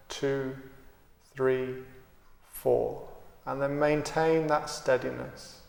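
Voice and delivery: calm, unhurried meditation guide with long pauses; guided meditation. Acted. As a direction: count and guide the breath steadily, leaving space between phrases. Leads a guided breathing meditation.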